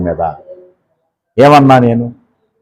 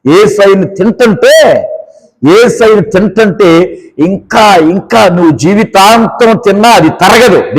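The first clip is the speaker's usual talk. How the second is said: full-force preaching; 7 semitones higher than usual. Real, not acted. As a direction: rouse the church to praise.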